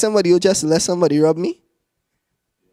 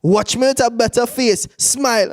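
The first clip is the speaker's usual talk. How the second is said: higher-pitched scolding order; mock stern mother; 7 semitones higher than usual. Mother ordering her crying son, mock stern. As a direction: play a mother demanding obedience.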